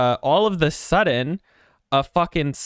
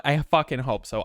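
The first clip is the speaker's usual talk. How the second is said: usual speaking voice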